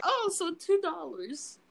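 slow, naive little realization; own idiot self. Retold or imitated. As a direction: play up your own cluelessness a little. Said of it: "My idiot self is like".

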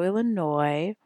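normal talking voice

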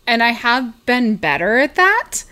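ordinary talk, nothing marked

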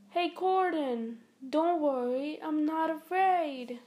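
mock-brave idol voice. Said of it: "That was an impersonation of [name]".

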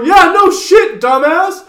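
high, loud mocking shout; snotty yell. Buddy yelling back at his mother, high and loud. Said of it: "Opens his door".

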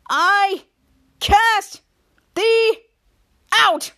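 hammed-up shout of the banishment; shouted decree. [name], running to the door, shouts the banishment at Mika.

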